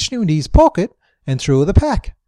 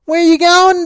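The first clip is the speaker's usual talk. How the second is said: high, raised yell; 12 semitones higher, 5 dB louder, slower than usual. A narrator's reading. Dingo yelling as Bricko runs off, high and raised. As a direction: shout after him. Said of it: "Dingo yelled".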